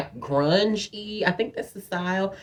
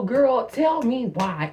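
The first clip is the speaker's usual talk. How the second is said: ordinary delivery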